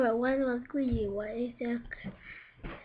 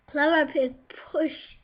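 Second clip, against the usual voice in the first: ordinary delivery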